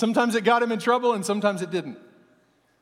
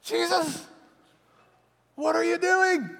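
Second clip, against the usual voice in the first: much higher, exasperated voice; scolding Peter; 10 semitones higher than usual. Retold or imitated. Peter reprimanding Jesus, high and exasperated. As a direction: scold your friend in disbelief. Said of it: "He's like".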